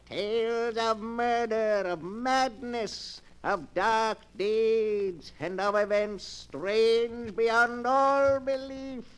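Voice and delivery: ordinary delivery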